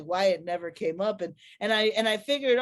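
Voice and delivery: ordinary delivery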